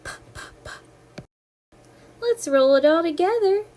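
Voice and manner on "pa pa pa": unvoiced pa pa pa like a train; breathy puffs for train sound; whispered puffing p. Shown as a demonstration. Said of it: "What sound does P make?".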